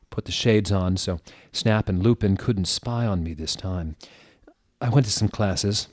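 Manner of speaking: normal talking voice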